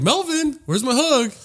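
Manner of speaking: very high imitation of a girl calling out; high-pitched mock girl voice; girl demanding a hug. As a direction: mimic a girl's whiny call, way up high.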